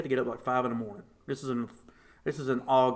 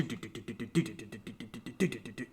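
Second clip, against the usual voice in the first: heartbeat imitation; 3 semitones lower, 10 dB quieter, faster than usual. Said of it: "my heart's just do".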